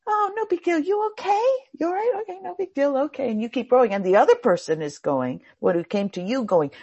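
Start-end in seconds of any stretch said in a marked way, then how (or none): 0.1-3.3 much higher, breezy reassurance; easygoing calm. Retold or imitated. You reassure the other boater after the bump, easygoing. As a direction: shrug it off kindly. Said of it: "you sit there and you go".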